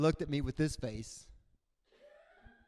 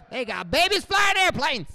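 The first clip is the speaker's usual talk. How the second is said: put-on much higher and louder, a scoffing old man; loud incredulous veteran; 10 semitones higher, 10 dB louder than usual. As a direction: blurt it out in disbelief at a baby pilot.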